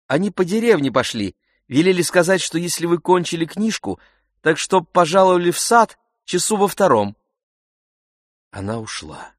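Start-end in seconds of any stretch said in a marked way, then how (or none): 0.1-7.2 high, lively messenger maid, eager to report back; young lady's maid. A narrator's reading. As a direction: voice the maid in a raised, bustling pitch. Narrator voices the maid Katya.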